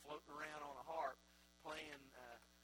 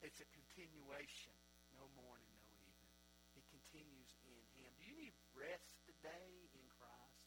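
lower, softer, intimate sermon voice; quiet reflective preaching; 3 semitones lower, 7 dB quieter than usual. Acted. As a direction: draw the congregation close, gently.

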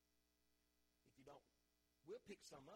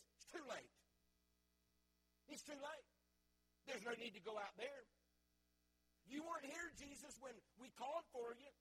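mock grieving Martha, higher pitched; Martha's complaint; 8 semitones higher, 6 dB louder than usual. Preacher voicing Martha blaming Jesus, high and pleading. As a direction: voice her hurt accusation, high and pleading.

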